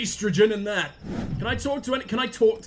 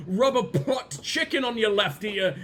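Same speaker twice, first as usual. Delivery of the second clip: normal talking voice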